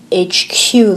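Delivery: ordinary delivery, nothing marked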